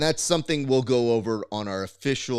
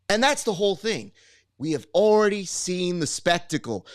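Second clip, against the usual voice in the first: Chael Sonnen impression; 3 semitones higher than usual. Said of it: "see if I can do this in Chael's thing".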